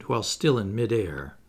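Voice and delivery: ordinary delivery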